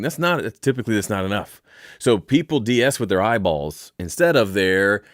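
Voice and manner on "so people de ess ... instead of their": ordinary talk, nothing marked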